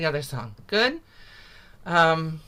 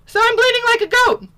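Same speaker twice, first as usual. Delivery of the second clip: much higher, louder bleating voice; goat-voiced; 8 dB louder than usual. Teacher saying the line in a playful goat voice.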